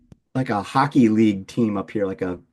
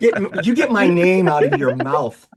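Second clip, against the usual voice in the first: mock outrage; 5 semitones higher, 5 dB louder than usual. Mock outrage at name joke.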